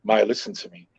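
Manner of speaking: a father calmly calming his daughter, soft and low. Retold or imitated. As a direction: settle the daughter down gently. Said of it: "I'll be like".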